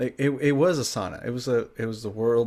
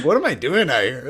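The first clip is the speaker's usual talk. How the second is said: loud, laughing what am I doing; laughing disbelief; 3 semitones higher, 7 dB louder than usual. Retold or imitated. Retelling knocking doors in heat, laughing loud in disbelief. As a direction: crack up at yourself.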